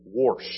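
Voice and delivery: Warsh with an r slipped in before the sh; Warsh, the regional way of saying wash; mother-in-law's accent. Retold or imitated. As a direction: say Warsh like a southeast Ohio local. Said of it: "She says things like".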